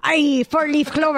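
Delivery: hammed-up Irish lilt; Irish accent. Joking with the wife about a four-leaf clover, Irish accent. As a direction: play at a jokey Irish brogue. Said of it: "that was my Irish accent".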